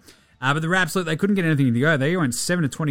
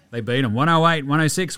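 ordinary delivery

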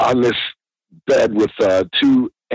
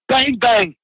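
high-pitched panicky pleading; mock tearful begging, pitched up; imitated crying; 10 semitones higher than usual. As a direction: imitate a terrified man wailing his excuses. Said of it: "And he's crying".

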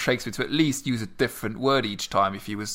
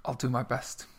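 normal talking voice

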